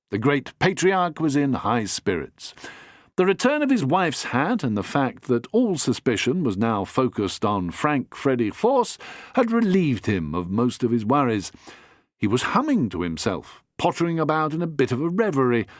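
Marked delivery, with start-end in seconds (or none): none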